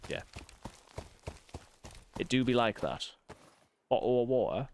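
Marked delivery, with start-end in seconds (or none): 3.9-4.7 lightly put-on regional English accent; a quick mock regional English accent; playful regional English accent; other English accent. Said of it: "I do an impression of someone from a different part of England".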